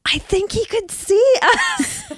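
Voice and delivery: high, slow horrified realisation; mock dread. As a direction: draw it out high, imitating an alarmed boss. Boss's retold horrified realisation.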